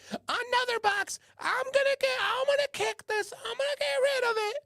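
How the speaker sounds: squealing mock falsetto fit; hammed-up wife at her wits' end, very high; exasperated wife impersonation. Wife fed up at another box arriving, shrieky mock falsetto. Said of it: "now she's like".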